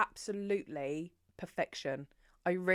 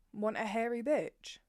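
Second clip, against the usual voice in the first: ordinary talk, nothing marked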